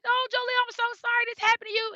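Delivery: high-pitched, sympathetic fussing; sympathetic school nurse. Retold or imitated. Voices nurse sympathizing with child.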